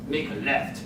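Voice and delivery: a quiet, gruff muttered order from the back seat. Retold or imitated. Carjacker in the back seat orders the driver, gruff mutter. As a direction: mutter it like a stranger giving orders. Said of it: "a gruff voice mutter".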